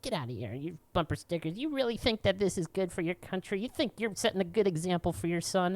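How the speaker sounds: high mocking whine; mock self-righteous scolding; mocking stranger. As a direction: lightly nag like a preachy stranger. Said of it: "He's just like".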